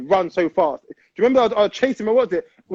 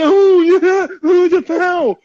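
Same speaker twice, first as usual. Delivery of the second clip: higher, louder mock cry of foul; mock diver; 8 semitones higher, 5 dB louder, much slower than usual. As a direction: ham up a fake foul claim. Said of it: "and say".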